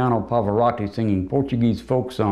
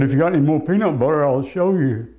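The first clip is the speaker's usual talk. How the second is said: mock tough threat; 5 semitones higher than usual. Roast joke quoting Farley.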